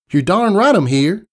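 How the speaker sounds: Southern country mare. Acted. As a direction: give it a down-home Southern twang. Applejack voiced in story.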